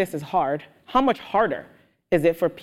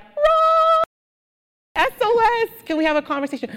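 mock panicked call; 4 dB louder than usual. Retold urgent call for help.

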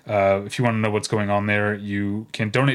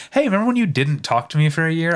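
higher-pitched hypothetical quote, lightly put on; mock-casual confrontation; 6 semitones higher, 3 dB louder, faster than usual. Host imagining raising an old snub with friend, mock-casual confrontation. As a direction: toss it out as an imagined, higher-voiced reminder.

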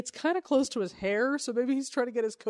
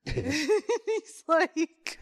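laughing; 7 semitones higher than usual. Real, not acted.

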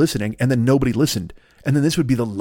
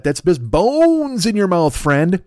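slow mock emphasis; 5 semitones higher, much slower than usual. Mock lecture to listener.

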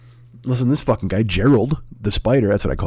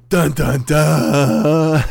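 mock dramatic sting; 4 semitones higher, much slower than usual. Dramatic sting after spider heaven.

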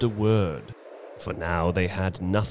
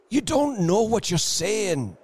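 shaken; 12 semitones higher than usual. Acted. Shaken husband pleading.

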